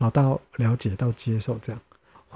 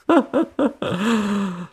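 bright burst of laughter; amused high laugh; 9 semitones higher than usual. Real, not acted. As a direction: laugh up high at the stuck friend. Laughing at the friend's dilemma.

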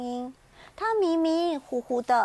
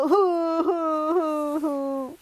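puffing breaths; 3 semitones higher, 5 dB louder, much slower than usual. Acted. Lion blowing on stinging eyes.

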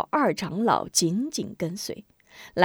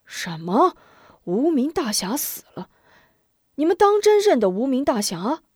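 rising, faster, shocked questions; shocked elders; 6 semitones higher than usual. A narrator's reading. Two elders shocked to hear the hero is dead. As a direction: gasp it out in disbelief. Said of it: "大吃一惊".

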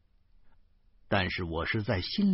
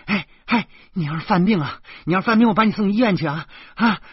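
high, loud and fast panicked pleading; alarmed cry; 10 semitones higher, 8 dB louder, faster than usual. A narrator's reading. Wife crying out in alarm to stop her husband, high and loud. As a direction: rush to stop him before he falls ill. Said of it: "老婆惊呼道".

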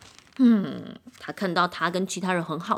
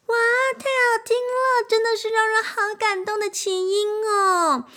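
high, gushing admirer swooning over the violin; admiring fan voice; 13 semitones higher than usual. A narrator's reading. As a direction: coo with delight at the music. Admiring instruments praise violin.

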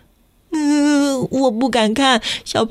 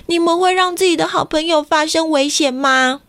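ordinary delivery

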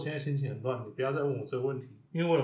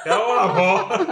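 loud and high, words breaking into laughter; laughing answer; 7 semitones higher, 15 dB louder than usual. Real, not acted. Answering the host about who Yaoyao is, laughing loudly. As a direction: confess it while cracking up. Laughing while naming his wife.

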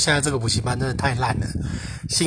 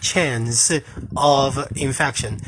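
slow, careful repeat of "chance of infection"; slow model repeat; much slower than usual. Shown as a demonstration. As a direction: say "chance of infection" slowly and clearly. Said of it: "字就是chains".

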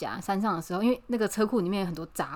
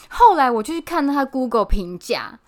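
ordinary delivery

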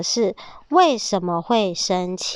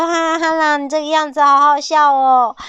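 higher, louder giggly tone; amused piglet; 6 semitones higher, 5 dB louder than usual. Acted. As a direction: laugh at the funny wolf. Piglet laughs at wolf.

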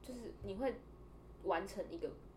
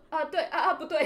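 flustered stammer; 5 semitones higher, 7 dB louder than usual. Retold or imitated. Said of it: "就是我直接傻眼到我说".

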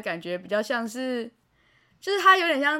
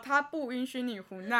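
normal talking voice

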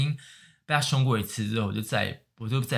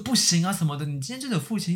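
scolding wife; 6 semitones higher than usual. Retold or imitated. Wife scolding husband in story.

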